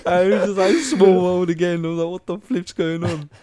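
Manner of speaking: high, loud, helpless laughter in the words; laughing while talking. Real, not acted. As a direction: talk through a real laughing fit. Cracking up at his own punchline.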